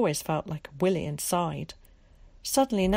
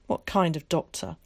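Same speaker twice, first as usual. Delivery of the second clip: ordinary delivery, nothing marked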